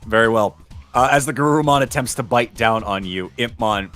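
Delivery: ordinary talk, nothing marked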